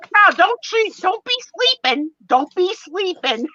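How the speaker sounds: high, loud hype-man yell; yelling at the crowd. Retold or imitated. Whatnot seller hyping the live audience, loud hype yell. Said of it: "they yell at the crowd".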